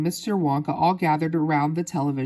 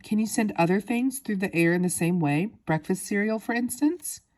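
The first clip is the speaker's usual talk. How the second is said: usual speaking voice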